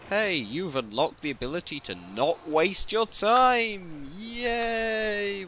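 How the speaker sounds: high, loud mock-cheerful announcer; sarcastic game announcer. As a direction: parody a chirpy unlock message, dripping sarcasm. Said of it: "It's like".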